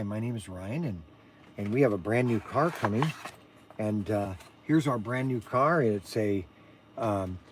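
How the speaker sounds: low, soft, monotone son's voice; low, soft monotone mimicry of the son presenting a car; monotone Ryan impression. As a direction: play the son droning with zero excitement. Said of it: "I kinda did an imitation of Ryan".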